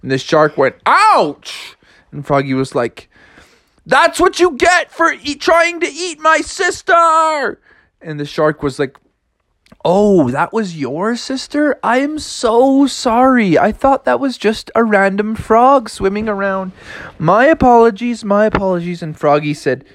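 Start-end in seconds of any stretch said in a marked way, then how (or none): 0.9-1.8 high cartoon "ouch"; yelp of pain. Shark hit by a frog, pained yelp. 3.8-7.6 high, drawn-out cartoon scolding; scolding triumph. Frog to shark who attacked his sister, high scolding. 9.8-19.0 apologetic shark. Acted. Shark to frog about his sister, over-earnest apology.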